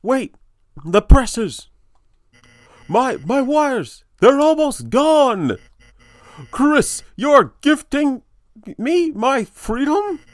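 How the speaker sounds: high, loud, stunned outburst; frantic salesman puppet. Acted. Puppet learning Chris is gifting it freedom, loud astonishment. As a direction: cry out in drawn-out, high amazement. Voices game character's dialogue.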